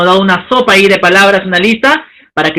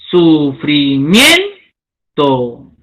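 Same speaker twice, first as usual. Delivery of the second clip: sufrimiento drawn out very slowly and lower, leaning on MIEN; slow stress demo; 6 semitones lower, much slower than usual. Shown as a demonstration. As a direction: stretch it to find the stressed syllable.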